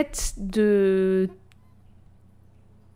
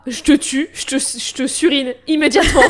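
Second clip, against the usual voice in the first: menace exagérée; 5 semitones higher, 8 dB louder than usual. Acted. Acted absurd killer reply.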